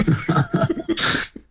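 a sudden high laugh; high burst of laughter. Real, not acted. Amused by hot dish question.

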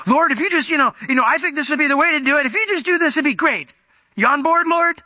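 high, hopeful sales pitch to God; eager pleading pitch. Acted. Believers selling the Lord their own plan, eager high pleading. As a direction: pitch your plan brightly, ending on a high question.

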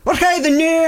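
stepdad's Scots brogue, pitched high; imitated Scottish accent. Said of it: "he'd start with his Scottish accent".

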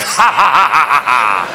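spaced-out taunting ha-ha, dropping in pitch; mock villain laugh. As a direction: laugh back defiantly, ha by ha. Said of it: "It's like".

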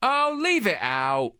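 slow cockney scoff, mock; British accent. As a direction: drag it out like a Londoner.

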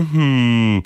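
a musing hum, pondering; mock-thoughtful hum; thoughtful hmm. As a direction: go hmm like you're puzzling it over. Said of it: "Things that make you go".

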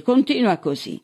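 high-pitched egging on; goading. A narrator's reading. Sheriff daring his handcuffed prisoner, goading. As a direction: goad him to keep going. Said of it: "lo incitò Sili".

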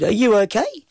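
put-on high, rising boy's question. A narrator's reading. Boy grabbing his throat to ask the voice, high-pitched. Boy asking the voice inside him.

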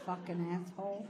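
muttered jibe; muttered insult. Retold or imitated. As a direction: curse him quietly aside. Muttered aside watching the doorman.

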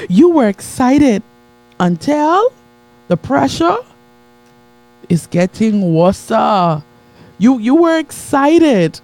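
slow, high, emphatic preaching; building preach. Acted. Preacher hammering the point to his congregation, slow. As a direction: stretch each word to the crowd.

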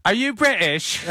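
high-pitched, put-on British accent; mock British accent. Shown as a demonstration. Producer showing off his only British accent line. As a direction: call out in a silly British accent. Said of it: "[name] can do an accent".

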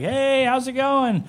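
high, drawn-out singsong hello; acted happy homecoming call; cheery greeting. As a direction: greet the house brightly and slowly. Acts cheerful arrival home.

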